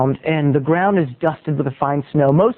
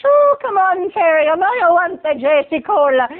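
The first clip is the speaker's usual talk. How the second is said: pitched-up Irish wheedling; Irish neighbor; 10 semitones higher than usual. Acted. Irish neighbor urging cola on boy, Irish wheedle. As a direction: coax him in a high Irish voice. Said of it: "That's her Irish".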